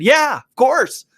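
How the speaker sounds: high, slow "of course"; matter-of-fact uncle. Retold or imitated. Uncle answering his nephew's doubt, high and slow. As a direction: draw it out like it's obvious. Uncle finds the question obvious.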